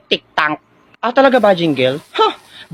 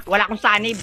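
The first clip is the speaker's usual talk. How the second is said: normal talking voice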